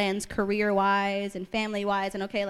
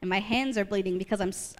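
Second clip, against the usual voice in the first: ordinary talk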